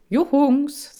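high, loud, put-on cheerful call; forced cheer. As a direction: overdo the cheer to win them over. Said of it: "sagte ich betont fröhlich". (betont fröhlich, hoch und laut gerufen)

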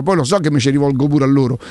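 ordinary talk, nothing marked

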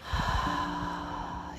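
long quiet breathy sigh; soft exhale sigh. Shown as a demonstration. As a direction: exhale slowly and softly. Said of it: "as you exhale".

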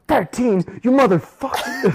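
high, loud, furious outburst; furious kid yelling. Retold or imitated. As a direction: screech it like a raging kid. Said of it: "the kid just gets mad and like".

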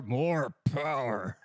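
slow, drawn-out macho demand; sitcom handyman catchphrase. Acted. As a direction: stretch it out like a hammy handyman.

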